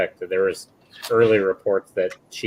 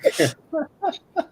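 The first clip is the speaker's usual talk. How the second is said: squealing embarrassed laugh; a high startled giggle. Real, not acted. Embarrassed laugh after a slip.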